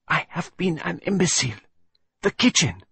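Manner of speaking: a detective struck by the answer; lightly Belgian cry of discovery; Poirot's eureka. Acted.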